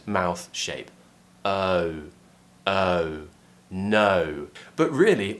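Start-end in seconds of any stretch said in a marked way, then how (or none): 1.4-4.5 long gliding "Oh", relaxed start to rounded end; British O diphthong. Shown as a demonstration. Teacher drills the diphthong, long gliding British "Oh". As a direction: draw out the glide in "Oh, oh, no". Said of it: "tongue pulling back and upwards".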